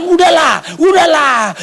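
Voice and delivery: imitating a fed-up father; exasperated plea. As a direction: beg the crying baby to stop. Father pleading with wailing baby.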